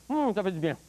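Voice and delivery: usual speaking voice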